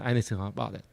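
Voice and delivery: ordinary delivery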